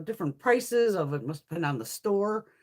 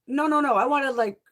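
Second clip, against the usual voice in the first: loud, higher-pitched objection; insistent protest; 5 semitones higher, 5 dB louder than usual. Retold or imitated. Customer to clerk who brought one carton, insistent protest. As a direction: cut in firmly to correct the order.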